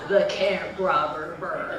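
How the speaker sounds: slow, loud, ominous stretched-out name; spooky uncle. Acted. Uncle ending his scary bird tale, ominous. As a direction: draw out the last word like a campfire scare. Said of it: "terrified me at our family campout".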